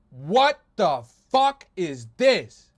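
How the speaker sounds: slow, loud stunned disbelief; disbelieving. Real, not acted. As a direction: drag it out in shock. Streamer shocked by AI memes.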